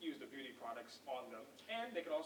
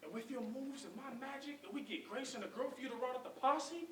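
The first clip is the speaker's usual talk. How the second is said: persuasive hustling Booth; 4 semitones higher than usual. Acted. Booth tempting Link with money and women, persuasive. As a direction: coax them with a dreamy, building sales pitch.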